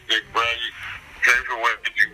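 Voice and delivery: ordinary talk, nothing marked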